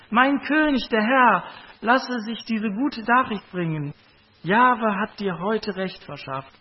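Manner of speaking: high and loud, eager herald; calling out good news. Acted. Nubian messenger calling his news to the king: loud, high. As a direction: announce it proudly to the king. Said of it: "und rief".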